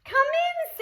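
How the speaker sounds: very high sing-song welcome. A narrator's reading. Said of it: "said Ma".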